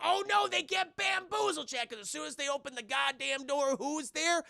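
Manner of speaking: high, excited storyteller reveal; excited, high-pitched suspense; dramatic build-up. Real, not acted. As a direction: hype up the twist in a high voice.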